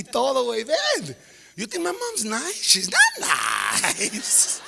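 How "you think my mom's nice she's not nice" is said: slow build into a shout; warning cousin. Retold or imitated. Kid to cousin at party, slow then shouting.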